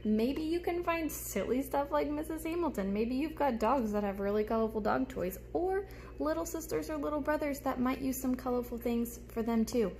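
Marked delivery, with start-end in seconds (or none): none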